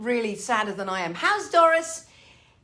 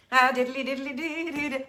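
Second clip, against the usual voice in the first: mock Irish jig. Mock Irish lilt for Dublin.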